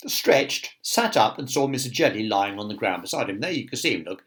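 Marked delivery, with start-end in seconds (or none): none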